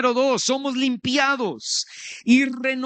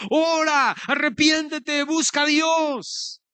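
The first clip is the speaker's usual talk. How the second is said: urgent, raised-pitch cry to repent; impassioned high shouted plea; preacher's acted shout; 5 semitones higher, slower than usual. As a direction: call it out high, stretching the last words. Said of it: "el Espíritu Santo me está gritando".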